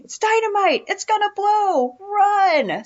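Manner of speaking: high, loud acted scream of alarm; screaming warning. Fake cop warns bank employees of dynamite, screaming. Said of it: "he starts screaming".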